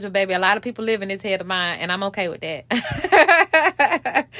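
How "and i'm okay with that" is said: laughing. Real, not acted. Laughing self-acceptance aside.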